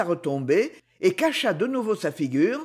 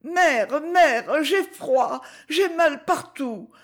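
put-on higher, pleading, desperate girl's voice; desperate cry; 8 semitones higher, much slower than usual. A narrator's reading. Zina begging her mother, desperate and pleading. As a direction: cry out in cold and misery, begging. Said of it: "cria Zina d'une voix désespérée".

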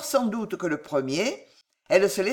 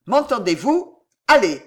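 put-on sharp, louder order to a child; stern command; 4 semitones higher, 7 dB louder than usual. A narrator's reading. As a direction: send the child out firmly.

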